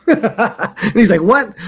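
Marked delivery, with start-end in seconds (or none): 1.2-1.5 high-pitched mock disbelief through laughter; amused imitation of a taken-aback teacher; incredulous teacher. Retells teacher's startled reaction.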